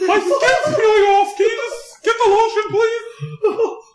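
squawky high cartoon duck voice, laughing; whining like a cartoon duck; Donald Duck voice. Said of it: "Donald Duck impression is fucking amazing".

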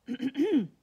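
loud, pointed throat clear. A narrator's reading. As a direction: clear your throat to get the class's attention. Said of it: "cleared her throat".

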